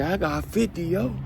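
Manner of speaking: high mock brag; bragging inmate. Inmate bragging about his sentence, high mock voice. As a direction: toss it off high like a boast.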